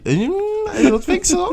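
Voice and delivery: squeaky high laughing "no way"; laughing denial. Real, not acted. To the host, laughing it off high.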